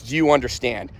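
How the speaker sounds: ordinary delivery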